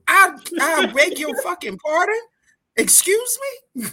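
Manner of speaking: high indignant squeak; shocked, offended pitch jump; outraged disbelief. Acted.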